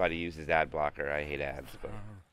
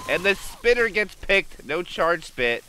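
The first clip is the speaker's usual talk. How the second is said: higher, louder hype call; excited; 12 semitones higher, 10 dB louder, slower than usual. Real, not acted. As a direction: ride the peak of the play. Big play call.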